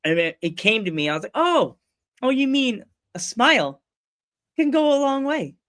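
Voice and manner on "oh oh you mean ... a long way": high-pitched "oh, that one" delivery; light up as it comes back; dawning realization. Retold or imitated. Greeter finally remembering his sign-off, sudden realization.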